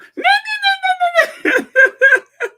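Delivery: high, loud burst of laughter; piercing real laugh; loud shrieking laugh. Real, not acted. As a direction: cackle out loud in disbelief. Laughing at the horrible voice.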